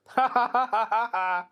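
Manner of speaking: loud, high peal of laughter; hearty laugh. Real, not acted. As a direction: crack up laughing. Laughs at the ominous quote.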